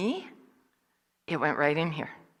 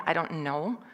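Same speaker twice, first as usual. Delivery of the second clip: ordinary talk, nothing marked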